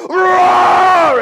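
loud high roar; hammed-up parental roar; mock roar. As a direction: roar like an angry beast. Parent's rage.

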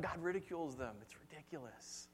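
ordinary talk, nothing marked

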